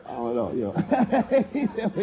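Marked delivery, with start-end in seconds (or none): 0.1-0.7 very low, noncommittal imitation; low mock reply. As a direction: drop way down for the reply. Voices reply to the retort.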